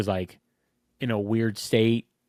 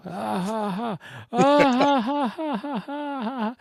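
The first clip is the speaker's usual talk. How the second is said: high, creepy mock cackle; fake Joker laugh; 13 semitones higher, slower than usual. Husband in bed fakes a creepy laugh at his wife, high cackle. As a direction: cackle up high to spook someone. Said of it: "like, a Joker laugh".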